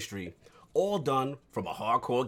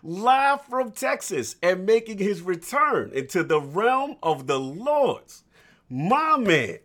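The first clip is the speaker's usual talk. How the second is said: building, louder announcer call, slowing; announcer hype intro; 4 semitones higher, 8 dB louder than usual. Acted. Host hypes guest introduction.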